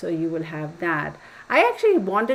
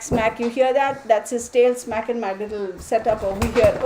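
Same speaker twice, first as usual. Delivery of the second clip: ordinary talk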